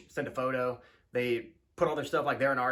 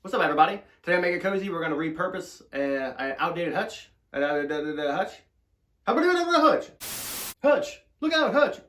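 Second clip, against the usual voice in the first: louder, higher show-intro voice, mock announcer; hammed-up host voice; 6 semitones higher, 9 dB louder than usual. Vlogger hamming up his show intro, loud mock announcer. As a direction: bellow it like a goofy TV host. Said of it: "I don't know why I'm talking like this".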